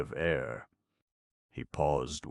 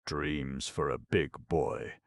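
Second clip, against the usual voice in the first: put-on big-boy label. Label on Notta's package, slow big-boy reading.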